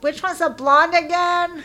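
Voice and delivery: mock-confused yell to the wife; playful yell. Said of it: "I'd be yell out".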